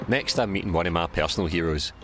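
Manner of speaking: ordinary talk, nothing marked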